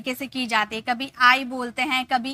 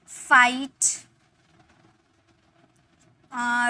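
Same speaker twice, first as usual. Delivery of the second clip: drawn-out model word; 5 dB louder, much slower than usual. Shown as a demonstration. Teacher slowly modelling right for students. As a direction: stretch right slowly, clear long आई. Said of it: "आप बोल सकते हो".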